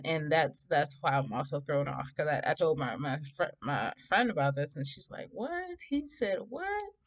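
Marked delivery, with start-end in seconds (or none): 5.3-6.9 high, softened squeal of retold surprise; shocked disbelief. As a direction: imitate a stunned friend, pitched way up. Friend reacting to shocking text.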